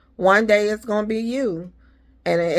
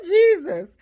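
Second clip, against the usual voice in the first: laughing through pain; 4 dB louder than usual. Real, not acted. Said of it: "but I'm laughing".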